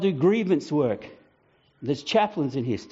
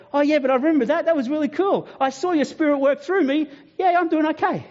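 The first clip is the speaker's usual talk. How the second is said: much higher, delighted; light up rereading a good day; cheerful remembering; 10 semitones higher than usual. Retold or imitated. Believer rereading his journal page, delighted and higher.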